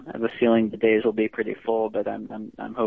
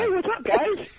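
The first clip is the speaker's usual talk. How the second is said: cheery caller voice. Pretend. Imagined has-been calling the show.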